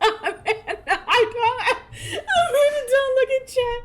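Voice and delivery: high, laugh-choked pleading; begging her to stop between laughs; laughing hard. Real, not acted. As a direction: streamer cracking up, pleading with her friend. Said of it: "I'm physically ill".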